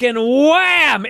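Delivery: high, drawn-out WHAM; impact exclamation. Retold or imitated. Host acting out the chair shot, big drawn-out impact. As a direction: hit "wham" like the blow landing.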